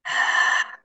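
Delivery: long breathy sigh out; audible exhale. Shown as a demonstration. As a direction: demo a slow release sigh. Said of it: "Allow yourself to make a little bit of noise".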